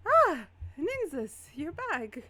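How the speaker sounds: high, loud cry of recognition; acted delighted surprise, drawn out; surprised greeting. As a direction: burst out as an old acquaintance walks in. Startled Lumiere greets returning visitor.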